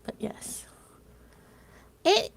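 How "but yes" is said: soft unvoiced "But yes"; whisper. Real, not acted. Streamer whispers an aside to chat. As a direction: breathe it out as a whisper.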